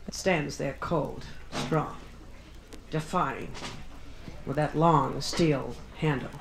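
slow, low, hushed and grand; mock-dramatic. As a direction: describe the machine like a dark monument. Mock-dramatic machine description.

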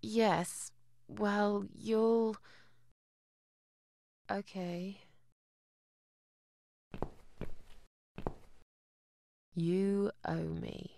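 soft, halting, reluctant agreement; grudging. Acted. Visitor pressed to support her lodger, grudging and quiet. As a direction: give in quietly with long hesitations.

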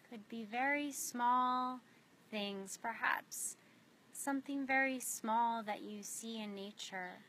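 unhurried meditation guide with long pauses; spacious, measured guiding voice, letting each image settle; guided meditation. Real, not acted. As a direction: lead them gently inward, phrase by phrase.

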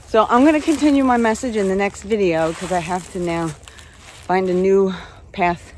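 winded from a hike uphill; talking while catching her breath. Real, not acted. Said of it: "I'm so out of breath from talking and walking".

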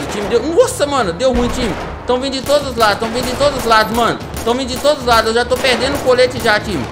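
loud, high-pitched panic under fire; panicked. Acted. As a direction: cry out in alarm, retreating. Ambushed from all sides in game.

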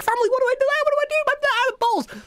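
a hammed-up cry for help to the parents; mock panic. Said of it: "ring them up and be like".

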